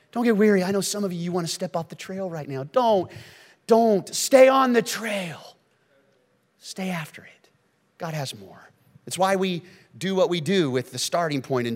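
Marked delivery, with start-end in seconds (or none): 2.6-5.6 higher and louder, slow insistent plea; emphatic plea. Real, not acted. Pastor pleading with listeners, loud slow urging. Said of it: "pastor urging congregation". 6.6-7.5 breathless whisper; whispered urging. Real, not acted. Pastor to congregation, whispered urging. Pastor pleading, then hushed.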